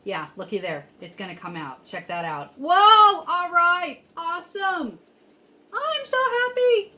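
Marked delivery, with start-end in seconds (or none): 2.6-6.9 high, loud thrilled exclaiming; excited. Real, not acted. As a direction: burst out with real joy. Waffles finally come out.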